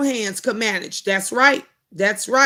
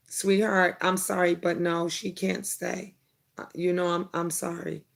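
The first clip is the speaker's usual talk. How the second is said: gentle apology; 6 semitones lower, 9 dB quieter than usual. Retold or imitated. Mother softly telling daughter's friend she can't stay, gentle and firm. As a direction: let her down softly, like a kind but firm mom.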